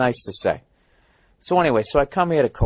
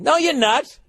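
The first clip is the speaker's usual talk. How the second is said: high-pitched, acted indignation; exasperated comeback to a phony; indignant retort; 9 semitones higher than usual. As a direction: snap back in mock outrage, pitch leaping up. Angry retort to absent driver.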